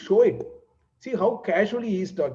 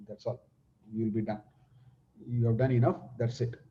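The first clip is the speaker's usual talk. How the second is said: much softer, offhand dismissal; casual offhand reply; 5 semitones lower, 6 dB quieter, faster than usual. Retold or imitated. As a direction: toss it off quietly, unbothered. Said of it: "he very casually says".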